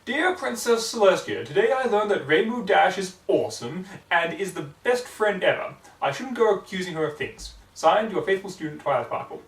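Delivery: prim student voice, lightly done; a hint of a prim studious unicorn; imitating Twilight. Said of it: "a passable imitation of Twilight Sparkle's voice".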